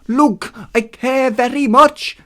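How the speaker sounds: slow, high, loud Hermes voice; slowly. Acted. Hermes saying he cares very much, slowly. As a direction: spell it out slowly, pitched high. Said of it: "Hermes said slowly".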